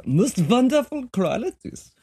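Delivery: high, slow mock German voice; mock German accent. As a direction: play up a German accent on "most wonderful qualities". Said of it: "I guess it's German".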